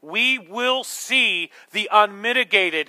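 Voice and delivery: forceful preaching. Acted. Preacher warning the congregation of God's wrath, slow forceful preaching.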